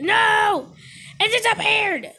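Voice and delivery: loud, high cry of dismay; dismayed shout. Pretend. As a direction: shout as the prey vanishes. Moose vanishes.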